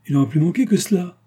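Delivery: high, loud, offended retort; indignée. A narrator's reading. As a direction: snap back, outraged at the very idea. Mère indignée dans une dispute. (réplique indignée, aiguë et forte)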